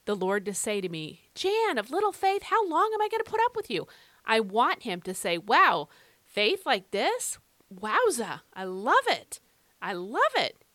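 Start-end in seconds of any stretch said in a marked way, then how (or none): 1.3-3.9 high, loud, exasperated telling-off; high-pitched mock rebuke, speeding up; exasperated scolding. Imagines the Lord scolding her. 5.4-10.6 loud, delighted amazement; big "wow" with emphatic, swooping approval; amazed delight. Acted. Imagines the Lord amazed and delighted.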